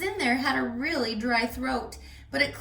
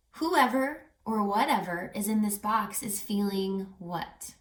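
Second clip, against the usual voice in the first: plain reading voice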